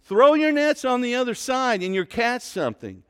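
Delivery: called out loud and high across the water; shouted call from shore. Retold or imitated. Stranger on the shore shouting to the fishermen, loud call. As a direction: shout it from the seashore to the boat. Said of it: "shout out to them".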